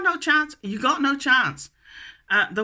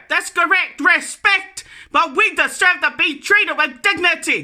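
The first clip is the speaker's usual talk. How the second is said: angry rant; 4 semitones higher than usual. Real, not acted. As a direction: tear into the ministers with real rage. Ranting at government ministers.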